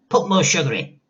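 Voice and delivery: grandma demands tea from George, bossy and cranky. Acted. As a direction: order the boy about like a cranky old lady. Grandma voiced in story.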